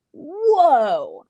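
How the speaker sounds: stunned whoa; amazed whoa. Retold or imitated. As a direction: let it out slowly, floored by what you just read. Reaction after reading the script.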